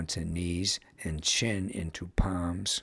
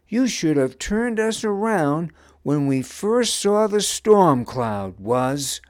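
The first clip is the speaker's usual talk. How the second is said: loud, higher-pitched accusing complaint; bold complaint; 9 semitones higher, 7 dB louder than usual. A narrator's reading. Seer confronts Wuz over the storm, complaining. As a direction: boldly lay the blame on him. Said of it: "she complained".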